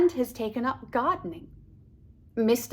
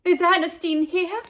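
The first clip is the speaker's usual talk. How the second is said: louder eager question; calling out; 7 semitones higher, 5 dB louder than usual. Acted. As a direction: ask it up high. Parents asking at ballet.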